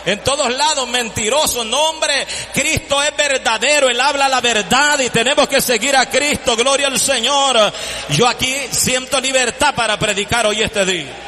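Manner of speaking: full-force pulpit preaching; fiery preaching. Acted. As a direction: preach it out to the congregation. Preaching about Christ, liberty.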